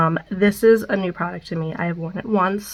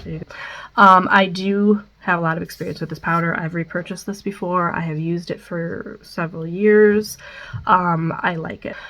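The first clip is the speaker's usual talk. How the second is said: ordinary talk, nothing marked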